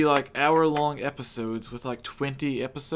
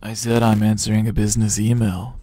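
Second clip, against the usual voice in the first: loud; 4 semitones lower, 7 dB louder than usual. Real, not acted. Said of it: "I can't hear him".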